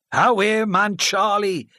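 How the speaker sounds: loud, high rebuke across the hall; called out. Acted. The chairman calling to his son from the stage, loud. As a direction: call him off from the stage. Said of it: "called from the stage".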